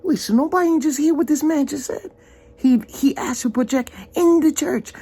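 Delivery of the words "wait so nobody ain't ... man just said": much higher, fast, incredulous; can't believe nobody else heard it; high-pitched stunned retelling; shocked disbelief. Retold or imitated. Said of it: "Like, I'm like".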